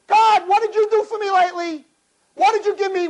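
high, loud whiny demand of God; mock demanding prayer. Self-centred worshipper nagging God, high-pitched demanding. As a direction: pester God with an exaggerated complaint.

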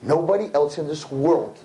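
ordinary delivery

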